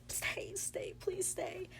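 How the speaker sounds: hushed, high, half-whispered begging; retold desperate plea. Heroine pleads with dying love to stay, retold hysterical.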